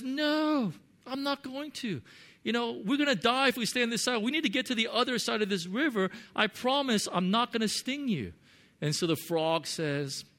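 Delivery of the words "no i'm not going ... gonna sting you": higher, faster pleading scorpion voice. Acted. Preacher voicing the scorpion begging the frog for a ride, high and quick.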